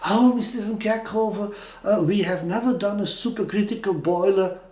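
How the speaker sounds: high, prissy sceptical head office voice; imitating a fussy head office; head office attitude. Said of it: "stupid attitude of my company".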